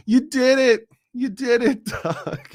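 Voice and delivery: hammed-up impression of a politician cheering someone on; high, laughing put-on voice of a public figure; Kamala Harris impression. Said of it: "in my best Kamala Harris voice".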